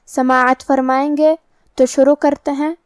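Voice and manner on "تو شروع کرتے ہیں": ordinary delivery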